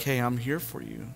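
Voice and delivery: gentle reassurance. Retold or imitated. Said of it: "And he's just like".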